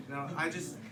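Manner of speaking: ordinary delivery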